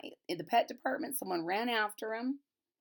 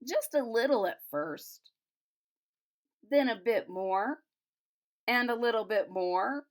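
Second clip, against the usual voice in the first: brighter storybook read, a bit higher; reading to children; 4 semitones higher, 4 dB louder than usual. A narrator's reading. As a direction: read it aloud like a picture book for kids. Reads picture book aloud.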